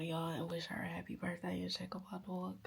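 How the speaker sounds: hushed whispered aside. Real, not acted. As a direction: drop to a whisper. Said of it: "whispered aside".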